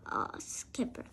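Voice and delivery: ordinary delivery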